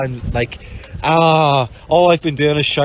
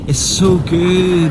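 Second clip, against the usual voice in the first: drawn-out delight; 5 semitones higher, 3 dB louder, much slower than usual. Real, not acted. Overjoyed sailor gushing.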